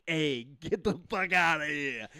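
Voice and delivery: slow mock Italian New Yorker; Italian New York accent. Denying ever sounding New York, mock Italian-American accent. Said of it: "I never did anything Italian-ish".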